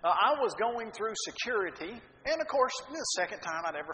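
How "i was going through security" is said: normal talking voice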